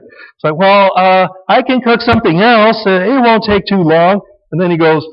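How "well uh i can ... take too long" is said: mock wife, pitched higher; appeasing wife. Wife appeasing husband over dinner, mock eager. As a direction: offer to cook again in an eager acted voice. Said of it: "wife rescuing".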